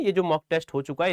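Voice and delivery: ordinary delivery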